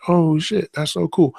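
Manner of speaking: high, thrilled outburst at the cool trick; a delighted dad blurting out in amazement; excited. Retold or imitated. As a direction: gush like you just saw something amazing. Dad sees son stunting.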